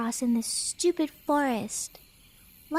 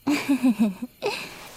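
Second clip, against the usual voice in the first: teasing giggle. Acted. Teasing the lost boy.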